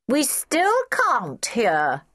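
high, very slow drawn-out call; high-pitched mock bellow; children bellowing back. A narrator's reading. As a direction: stretch each word as children calling out. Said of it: "bellowing back".

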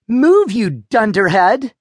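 sister growling. A narrator's reading. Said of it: "his sister growled".

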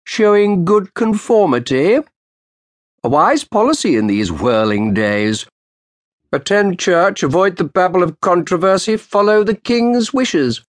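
mock the lawyer's show of piety; sharp, sardonic counsel. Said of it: "Roland smiled sardonically".

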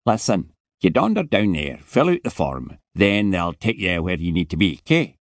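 put-on heavy Scottish accent, impatient and put out; a surly Scottish security man scolding; Scottish guard telling a boy to get up; grumpy Scottish guard. Scottish guard voiced in story.

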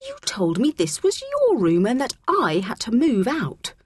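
high, indignant protest. A narrator's reading. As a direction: set the record straight, affronted. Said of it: "Abby said indignantly".

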